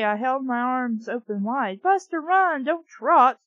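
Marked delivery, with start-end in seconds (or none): 1.8-3.4 higher, louder urgent plea; urging the slow dog on; desperate shout; begging shout. A narrator's reading. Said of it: "Mindy begged".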